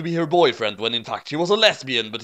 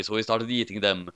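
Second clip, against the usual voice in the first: ordinary delivery, nothing marked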